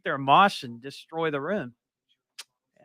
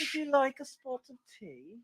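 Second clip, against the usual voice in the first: higher, softer, slow English butler accent; butlerish English accent; 9 semitones higher, 8 dB quieter, slower than usual. Shown as a demonstration. Showing the butler-style English accent, slow and soft. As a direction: offer tea like a prim English butler. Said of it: "Very Pennyworthish".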